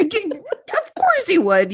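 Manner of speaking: high, slow sing-song tease; mock teasing. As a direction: drag it out high, teasing her. Teasing her friend.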